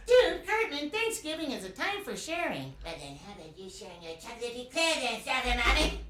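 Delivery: whiny bratty cartoon boy going home; high loud Cartman impression; Eric Cartman voice. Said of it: "I also do Eric Cartman".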